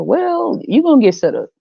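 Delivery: lightly voiced God figure; a hint of a booming deity. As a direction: play God talking down to his child. Comedian voicing God to child.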